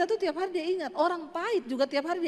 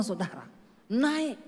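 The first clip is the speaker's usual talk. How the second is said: ordinary delivery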